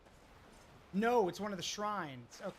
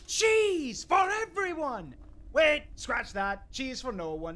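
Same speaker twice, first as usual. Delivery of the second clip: booming, high, slow proclamation; hammed-up mad-god decree; Sheogorath voice; 4 semitones higher, 8 dB louder, slower than usual. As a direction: announce cheese like a mad king. Quoting Sheogorath's famous line.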